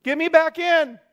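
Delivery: high, loud yell for rescue; acted panic, yelling from the water; panicked yell. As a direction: yell out like someone overboard. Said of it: "the one thing they're yelling".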